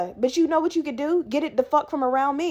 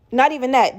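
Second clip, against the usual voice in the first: sharp gasp, then the words; gasping sob. Shown as a demonstration. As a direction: gasp for breath then repeat it.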